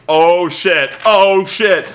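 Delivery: loud, mildly panicked exclamation; mock alarm. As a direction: blurt it out loudly in imitated dismay. Miz sees R-Truth coming.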